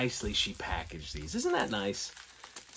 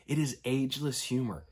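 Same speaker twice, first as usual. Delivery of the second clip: ordinary delivery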